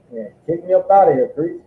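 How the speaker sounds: ordinary delivery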